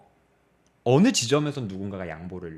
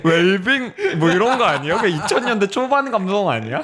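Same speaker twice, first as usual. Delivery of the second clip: loud, high-pitched, through laughter; laughing teasing; 8 semitones higher, 8 dB louder than usual. Real, not acted. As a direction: tease loudly while cracking up. 웃으며 옛 감성이라고 놀림. (크게 웃으며 놀리듯)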